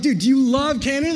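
high, loud excited question; retold shout of enthusiasm; hyped up. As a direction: ask it like an overexcited big kid. Hyped exchange with the boys.

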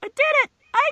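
very high, loud squealed brag; camp little boast. Acted. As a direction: squeal it like a prissy little victor.

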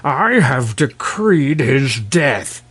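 slow, commanding king; crying out. A narrator's reading. King decreeing the prince's death, crying out. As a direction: pronounce it slowly as a decree. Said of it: "cried Terribus".

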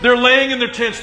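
ordinary talk

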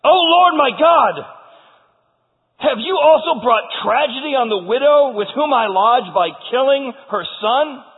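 anguished prophet's plea at full voice; crying out to God. Acted. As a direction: cry out in protest to heaven. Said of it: "he cried out to the Lord".

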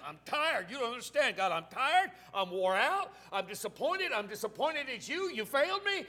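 worn-out complaint building to a high shout; exasperated protest. Acted. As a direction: climb from weary protest to a loud outburst. Acted exhausted protest to the Lord.

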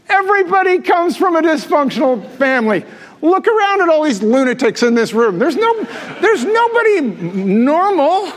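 high, laughing punchline; excited punchline. Real, not acted. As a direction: toss the joke to the room. Pastor's punchline to crowd.

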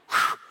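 sharp puff of air like a snot rocket; short noisy burst of breath; imitated nose blast. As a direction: mimic blowing hard through one nostril. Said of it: "just like snot rocket".

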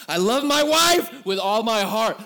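big, slow, loud declaration of love; emphatic loud declaration. Real, not acted. Preacher to guys in the room, loud mock-earnest declaration. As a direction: belt it out, hammed-up earnest. Joking about pregnant wife moods.

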